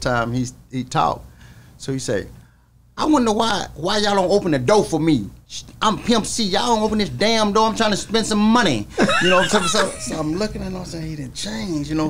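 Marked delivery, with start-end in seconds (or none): 3.0-8.9 imitating [name]. Security won't open mall door.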